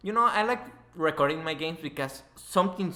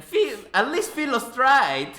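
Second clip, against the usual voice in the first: mock complaining fan; 10 semitones higher, 5 dB louder than usual. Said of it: "imagined fans complaining".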